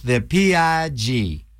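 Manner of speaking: low, slow, spelled-out letters; dramatic announcer reveal. Acted. DJ unveiling pig theme, in a deep slow trailer voice.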